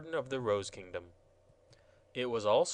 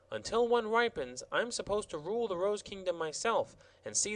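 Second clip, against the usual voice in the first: high, prim gardener explaining; self-important gardener rules; gardener's high voice, earnest; fussy royal gardener; 6 semitones higher than usual. Acted. Gardener voice held.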